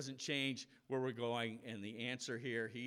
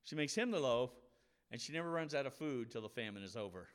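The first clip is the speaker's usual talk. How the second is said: ordinary delivery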